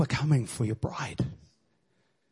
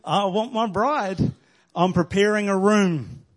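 higher, slow, yearning cry of desire; eager longing; 6 semitones higher, 4 dB louder, slower than usual. Acted. Bridegroom longing for his bride, eager yearning call. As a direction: call out for her, aching with longing.

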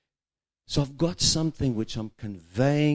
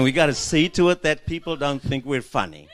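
ordinary talk

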